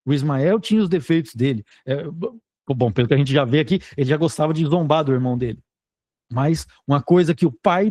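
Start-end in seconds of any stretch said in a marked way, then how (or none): none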